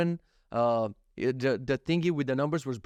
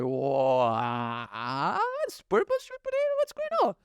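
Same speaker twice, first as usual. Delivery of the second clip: mock amazement; slower than usual. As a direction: jump way up in pitch in mock shock. Reacting to purple sweet potatoes.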